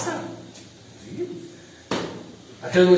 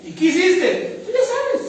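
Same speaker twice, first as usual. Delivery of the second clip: mock evasive reply; 13 semitones higher, 4 dB louder, slower than usual. Acted dialogue sinner and God.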